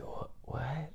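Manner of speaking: soft puzzled whisper; whispered what. Retold or imitated. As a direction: whisper back confused. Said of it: "she's like".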